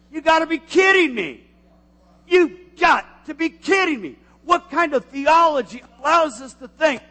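loud, high disbelieving cry; incredulous shout. Real, not acted. Pastor scoffing at bad theology, shouting in disbelief.

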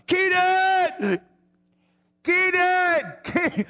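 high, loud drawn-out yell. Retold or imitated. Frantic father searching mall for lost son, yelling. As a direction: scream the name across a crowded mall. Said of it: "I mean, I'm yelling".

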